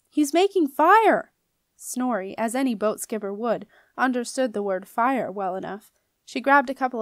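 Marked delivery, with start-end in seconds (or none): 0.1-1.3 loud, high, slow warning shout; alarmed yell. A narrator's reading. Jenna yelling a warning to the skipper. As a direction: spell out the danger at full voice. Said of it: "yelled Jenna".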